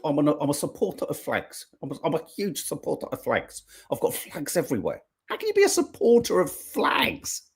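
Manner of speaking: a hint of the Labour leader, stammering the slogan; mock politician. Host parodying the Labour leader's flag talk, lightly voiced. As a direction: mimic a stiff politician repeating his line. Keeps parroting the flag line.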